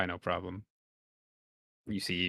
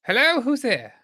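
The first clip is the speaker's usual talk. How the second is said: very high, loud welcoming merchant; squeaky shopkeeper greeting; high-pitched trader voice; 8 dB louder than usual. Acted. GM voices trader inside fort.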